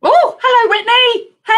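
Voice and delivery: very high, loud squeal of welcome; delighted greeting. Real, not acted. Author spotting a Seattle viewer joining, delighted greeting. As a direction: call out hello, high and thrilled.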